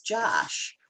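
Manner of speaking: usual speaking voice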